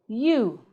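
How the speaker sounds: "you" with a clear y glide, long oo; contrast-word demo. Shown as a demonstration. Teacher contrasting two similar words, careful articulation. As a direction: model "you", starting on y. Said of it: "then we contrast with".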